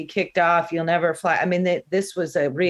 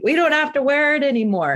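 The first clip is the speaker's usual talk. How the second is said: high-pitched imitation of a relieved passenger; mock gleeful announcement; 9 semitones higher, 3 dB louder than usual. Fellow passenger telling the plane masks are off, mock gleeful. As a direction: call it out high, imitating an excited traveler.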